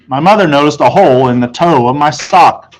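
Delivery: ordinary delivery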